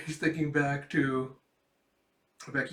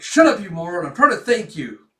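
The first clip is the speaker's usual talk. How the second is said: loud, raised-pitch irritation; mock exasperation; 4 semitones higher, 12 dB louder than usual. Boy clumsily thanking a girl, loud mock exasperation. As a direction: shout it as a play-acted telling-off.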